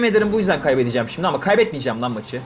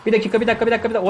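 higher, hurried repeats; alarmed wait; 6 semitones higher, much faster than usual. Real, not acted. Gamer startled mid-play, alarmed. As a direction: hold on, something's wrong.

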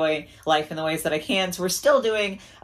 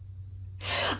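silent, breathy sob of a laugh; a laugh that catches in the throat from crying; breathless tearful laugh; 9 dB quieter than usual. Real, not acted. Crying over gifted doll.

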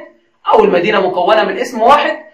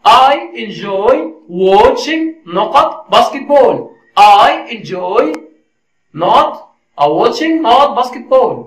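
slow dictation; 4 semitones higher, much slower than usual. Shown as a demonstration. Teacher reading a gap-fill question to learners, slow dictation. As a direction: read it very slowly, stretching each word before the gap.

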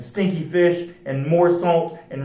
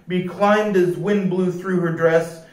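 ordinary delivery

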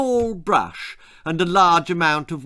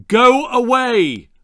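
high, loud, slow angry command; stern order; 9 semitones higher, 8 dB louder, slower than usual. Acted. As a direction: slam the door with your voice. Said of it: "ordered Angela's mother".

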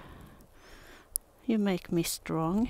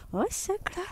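way up high, cooing; 13 semitones higher than usual. Real, not acted. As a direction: gush at the dog. Baby talk to dog.

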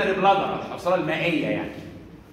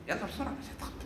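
whispered aside; 15 dB quieter, faster than usual. Real, not acted. Aside to someone during lecture.

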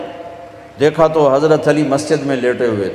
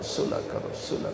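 an intimate hushed صلح کرو; whispering صلح کرو to the congregation; whispered plea; 13 semitones lower, 16 dB quieter than usual. Acted.

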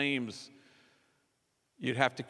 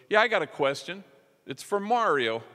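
calling out; 8 semitones higher, 6 dB louder than usual. Retold or imitated. Woman calls out from audience.

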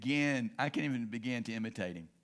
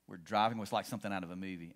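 ordinary talk, nothing marked

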